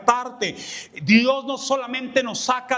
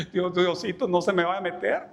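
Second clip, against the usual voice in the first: put-on young self praying scared; 6 semitones lower, faster than usual. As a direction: murmur it like a frightened kid. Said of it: "cuando estaba más joven dije".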